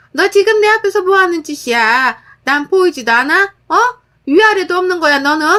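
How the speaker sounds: high, loud indignant scolding; outraged mother-in-law. Acted. Mother-in-law scolding her daughter-in-law for disrespect, high loud outrage. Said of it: "그러더라고요".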